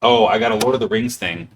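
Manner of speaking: ordinary talk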